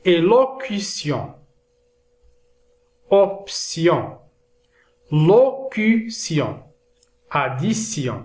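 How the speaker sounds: slow, syllable-by-syllable French word list; measured dictation with pauses between words; careful word modelling. Shown as a demonstration. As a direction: sound out each word for learners. Teacher reads example list for learners.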